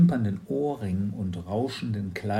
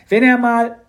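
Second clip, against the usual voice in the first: put-on high, loud girl's voice; a bright, bossy little girl; Mathilda's voice; 13 semitones higher, 13 dB louder than usual. Mathilda speaks. (hohe, laute Mädchenstimme)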